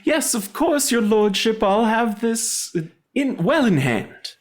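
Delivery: high-pitched, dutiful servant voice; obliging servant. Acted. In character, answering his lord.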